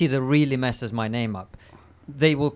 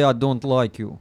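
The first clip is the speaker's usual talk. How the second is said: demonstrated Russian-accented dislike; slower than usual. Shown as a demonstration. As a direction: model the Russian accent with disdain. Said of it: "in your head, you say".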